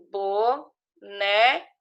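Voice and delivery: BONÉ split into bo, né; "boné" as two separate, drawn-out syllables. Shown as a demonstration. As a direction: sound out "boné" with a clear gap. Teacher sounding out syllables to join.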